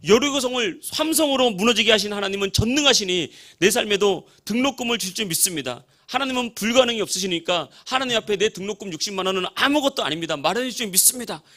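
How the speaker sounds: fervent, high-pitched loud praying voice; very loud prayer. Retold or imitated. Young man praying at dawn for his tuition, very loud and fervent. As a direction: cry out to God with full conviction. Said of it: "엄청 큰 소리로".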